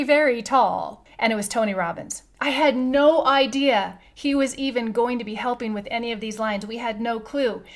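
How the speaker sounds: ordinary delivery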